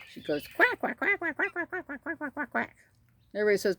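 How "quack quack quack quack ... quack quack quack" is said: rapid high quacks, trailing down softer; duck quacking. Retold or imitated. Keeper showing the duck talking to chickens, quacking away. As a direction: imitate an excited duck, fading out. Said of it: "The duck sometimes comes over and talks".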